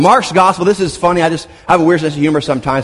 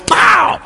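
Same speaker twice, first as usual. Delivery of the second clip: shouted pow, much higher; 14 semitones higher than usual. Pretend. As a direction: punch it out like a celebratory shout. Exclamation after high five.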